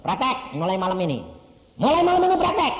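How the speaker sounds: loud, rising insistent order; firm command. Retold or imitated. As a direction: press the instruction home. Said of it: "Roh Kudus bilang". (perintah tegas dan keras)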